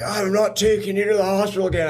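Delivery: high-pitched, put-on refusal to a friend; emphatic retold refusal. Refusing to drive the injured friend to hospital, emphatic high-pitched retelling.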